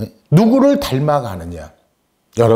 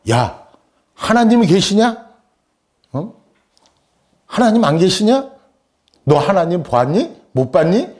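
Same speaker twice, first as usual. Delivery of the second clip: mock interrogation, higher pitched; challenging questioning; 8 semitones higher than usual. Kindergarten boy challenges girl about God, challenging. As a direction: press each question like a quarrelling child. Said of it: "이렇게 따져 물어요".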